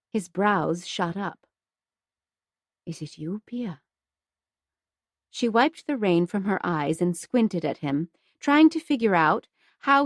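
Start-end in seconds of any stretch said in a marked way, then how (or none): 2.8-3.8 a hushed, wondering question; soft amazed. Acted. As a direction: say it barely above a breath. Finn amazed, softly.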